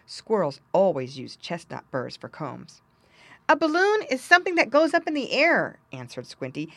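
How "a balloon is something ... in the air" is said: lightly voiced piglet, higher and louder; little pig explaining.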